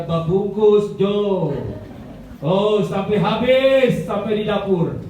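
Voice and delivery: full-force preaching. Acted. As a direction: proclaim it to the whole church.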